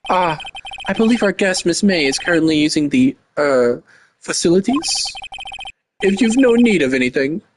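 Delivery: bellboy. Acted. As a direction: play an obsequious hotel bellboy. Voices the game's bellboy.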